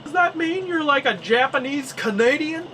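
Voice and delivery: curious locals. Retold or imitated. Locals questioning his heritage.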